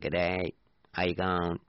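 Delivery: Australian greeting like a pond frog; lightly croaked mock Australian accent; froggy Aussie accent. Shown as a demonstration. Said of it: "That's a kind of a frog sound".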